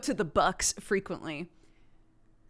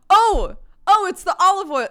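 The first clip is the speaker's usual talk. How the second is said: loud, high sudden realization; excited realization; 10 semitones higher, 13 dB louder than usual. Real, not acted. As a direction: blurt it out.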